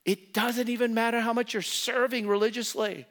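normal talking voice

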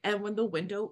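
ordinary delivery

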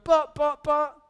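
high, loud mock objection; defensive protest. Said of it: "I won't get defensive".

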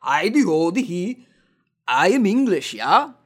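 hammed-up bad English accent impression; fake English accent. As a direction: badly fake an English accent, loud and hammy. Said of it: "put on that English accent".

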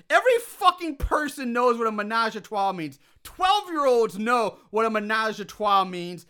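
high, loud, indignant disbelief; incredulous rant. Real, not acted. Host rants at the goth's ignorance of French, incredulous. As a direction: hammer it out in exasperation.

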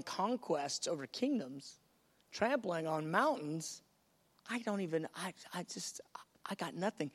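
a quiet, stunned trailing off; overwhelmed, small. Acted. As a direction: shrink under the size of it. Bride overwhelmed by the call.

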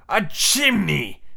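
high, slow captain exclamation; astonished pirate captain; exclaiming. Acted. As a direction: cry it out drawn-out in wonder. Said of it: "they both exclaimed".

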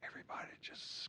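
hushed whisper. Retold or imitated. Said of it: "story of stunned class".

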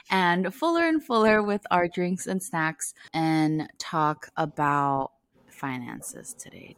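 ordinary talk, nothing marked

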